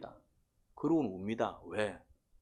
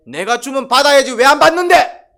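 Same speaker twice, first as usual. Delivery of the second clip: high, full-force indignant yell; angry demanding shout; 12 semitones higher, 19 dB louder than usual. Acted. Cain protesting God's refusal of his offering, angry shout. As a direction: roar it as an outraged demand. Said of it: "그 화가 난 게".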